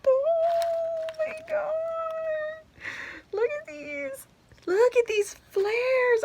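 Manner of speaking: very high, slow, drawn-out squeal of delight; real squeal over a box of treasures; excited squeal. Real, not acted. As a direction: let the joy run away with you. Opening a gift box, thrilled.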